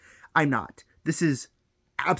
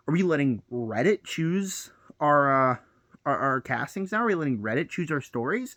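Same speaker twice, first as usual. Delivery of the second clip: normal talking voice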